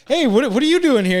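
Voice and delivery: high-pitched, loud mock dad; imitating dad. Dad finding the kids out back, high loud mock surprise. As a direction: squeak it out like a startled dad. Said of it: "He's like".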